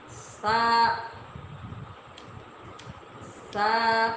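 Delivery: سا with the tongue against the teeth, no whistle; soft tha. Shown as a demonstration. Teacher drills soft سا with students. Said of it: "آپ پڑھیں گے سا".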